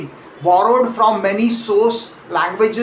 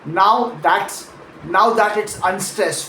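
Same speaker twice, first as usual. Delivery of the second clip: usual speaking voice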